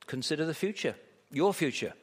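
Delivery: normal talking voice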